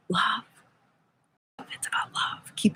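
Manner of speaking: soft breathy whisper of Mary's message. Retold or imitated. Relaying Mary's message to viewers in a whisper. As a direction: breathe the words as a sacred secret.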